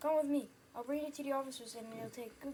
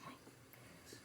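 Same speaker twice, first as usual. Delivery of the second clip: soft stunned whisper; 16 dB quieter than usual. Acted. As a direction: let it slip under your breath. Hushed shock.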